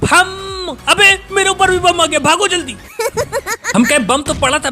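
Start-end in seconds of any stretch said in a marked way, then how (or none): 0.0-0.8 high, slow drawn-out taunt; slow smug taunt throwing the bomb; gloating taunt. Real, not acted. Throwing a grenade. 0.9-2.8 sudden panic. Real, not acted. Grenade lands on him.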